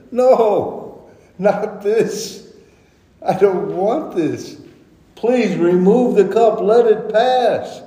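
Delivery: high, anguished mock plea; pleading first prayer. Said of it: "He had strong crying".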